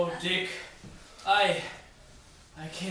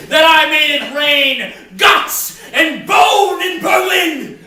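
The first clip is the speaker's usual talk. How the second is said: building to a rousing shout; rousing heroic shout; 6 semitones higher, 7 dB louder, slower than usual. Acted. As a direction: swell into a heroic war cry. Builds to heroic war boast.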